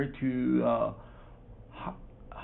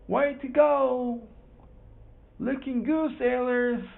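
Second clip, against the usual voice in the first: cheering from the shore; 11 semitones higher than usual. Acted. People on shore cheer the sailors.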